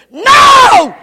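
a high, loud no; one huge defiant no; defiant shout. Acted. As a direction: yell the refusal. Sinner's defiant refusal.

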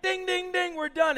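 high, loud dinging; bell and referee call. Retold or imitated. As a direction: call time over the fight.

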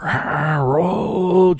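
long, drawn-out high screech; high screamed summons; shriek. A narrator's reading. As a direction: stretch the name into a hair-curling scream. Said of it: "let out a shriek".

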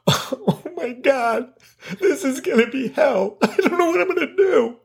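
mock despair. Mock-dramatic dread about cleaning.

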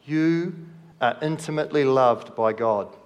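normal talking voice

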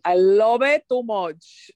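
loud, slow Dominican accent. Shown as a demonstration. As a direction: ham up a Dominican accent, stretching the words. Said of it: "my Dominican accent just ends in".

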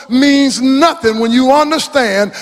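high, loud, drawn-out preacher's cry; preaching at full force. Acted. Preacher quoting Romans at full force to the congregation. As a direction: hold all I need long and high.

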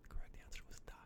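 hushed teasing whisper. Real, not acted. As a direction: whisper the answer slyly. Teasing correction after wrong answer.

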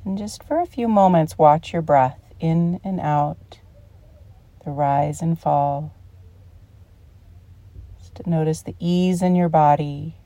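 calm meditation guide with long pauses; unhurried, soothing guided-relaxation voice. Real, not acted. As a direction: ease them into relaxation, phrase by phrase.

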